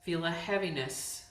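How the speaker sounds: calm, unhurried meditation voice with long pauses; guided yoga nidra. Real, not acted. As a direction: guide them into letting go, phrase by phrase. Leads yoga nidra practice.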